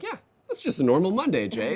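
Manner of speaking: high, loud, breezy mock shrug; mock nonchalant. Quoting event planners to Jay, breezy and unbothered. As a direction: toss it off like it's nothing, pitched high and loud. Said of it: "And you're like".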